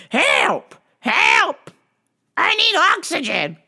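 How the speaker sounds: high, loud "Help! Help!"; shrieking mock plea from the caller; mock frantic old lady. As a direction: ham up a panicked caller.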